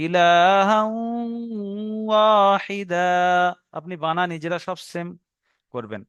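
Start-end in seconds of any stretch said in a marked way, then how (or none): 0.1-3.1 ইলাহা ওয়াহিদা slow, held nasal and long final a; ghunna demo. Shown as a demonstration. Teacher showing tanwin merged into waw, drawn-out nasal recitation. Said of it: "একালি পরিমাণ গুণনা করে পড়তে হবে".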